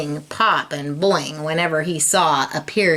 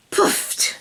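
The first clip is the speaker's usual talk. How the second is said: breathy whispered "Poofed". A narrator's reading. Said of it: "took a deep breath".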